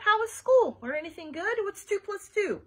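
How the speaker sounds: high, loud, goofball dad; called out. A narrator's reading. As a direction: fire cheery questions at the kids. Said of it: "he called out".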